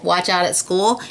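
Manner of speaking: ordinary delivery, nothing marked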